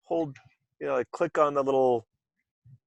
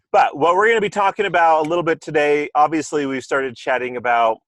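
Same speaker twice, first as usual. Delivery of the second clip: louder, faster show host delivery; radio voice; 4 semitones higher, 7 dB louder than usual. Acted. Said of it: "Need my, uh, radio voice".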